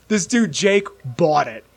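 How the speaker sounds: ordinary delivery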